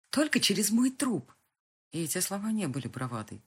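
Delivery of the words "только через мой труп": defiant refusal. Acted. Girl refuses him.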